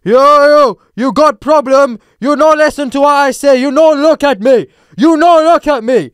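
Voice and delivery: put-on shouted broken-English foreign accent; high, slow, belligerent yelling in a mock accent; imitated foreign accent. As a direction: mimic an angry man's foreign accent at full volume. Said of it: "talking to me in this accent".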